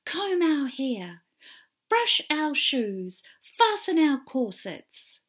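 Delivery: loud, high, slow bossy demands; shouting stepsisters. Acted. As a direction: bark the orders at her. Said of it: "they shouted at Cinderella".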